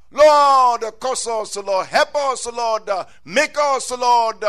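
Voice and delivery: impassioned preacher's invocation; fervent prayer. Real, not acted. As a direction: cry out to the Lord in short bursts. Preacher breaks into prayer for congregation.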